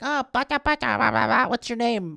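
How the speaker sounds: put-on high, babbling child's voice; little girl. Little girl babbling up at Rey, high child voice. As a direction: coo like a small girl meeting a stranger. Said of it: "some little girl comes up to her".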